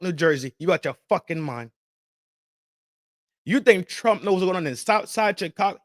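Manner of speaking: ordinary delivery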